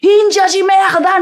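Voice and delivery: a horrified cry from the crowd; furious shout. Acted. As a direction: scream the insult back in outrage. Someone cries out in protest.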